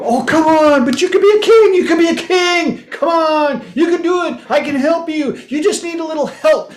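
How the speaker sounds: high, loud coaxing voice to a card; hammed-up begging the card to be a king; mock pleading.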